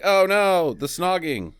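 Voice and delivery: mock dismay. Podcaster retelling Zara walking in on them, mock dismay. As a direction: exclaim it in hammed-up alarm. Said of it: "She's like".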